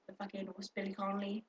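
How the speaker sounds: normal talking voice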